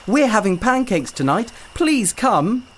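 high, eager blurt. Acted. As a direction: blurt it out excitedly. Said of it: "Adika blurted out".

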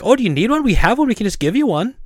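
high, lilting helpful voice; retold friendly shop-clerk singsong; polite offer. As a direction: offer it sweetly, pitched well up. Acting his polite offer to customer.

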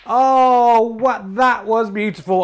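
high, loud burst of delight; thrilled outburst. Acted. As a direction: gasp in awe at the shot. Commentator thrilled by a shot.

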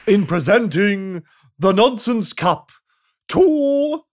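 slow, loud royal proclamation; pompous king. Acted. The king addressing the crowd, slow and pompous. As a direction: boom it out grandly and slowly. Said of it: "announced the king".

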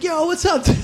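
high, excited bro voice; bro greeting. Retold or imitated. Buddy greeting an old dorm neighbor, imitated high and eager. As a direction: mimic the dude greeting like an old pal. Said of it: "he's like".

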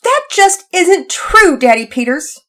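high, loud, drawn-out tearful protest; upset child wailing to her father; about to cry. Acted. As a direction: deny it on the verge of tears. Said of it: "sounded as though she was about to cry".

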